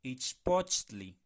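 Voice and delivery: "Ichpochtli" despacio y marcado; pronunciación lenta. Shown as a demonstration. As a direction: marca bien tli al final. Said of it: "separarla por sílabas". ("Ichpochtli" lento y claro)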